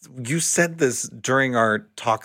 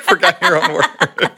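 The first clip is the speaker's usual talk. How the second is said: laughing; 4 dB louder than usual. Real, not acted. Laughing at partner's blunder.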